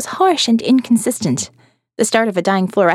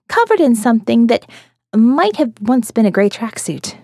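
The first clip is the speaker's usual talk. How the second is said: plain reading voice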